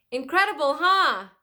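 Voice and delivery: loud, high thrilled yell. Acted. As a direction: yell over the wind. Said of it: "yelled Annie".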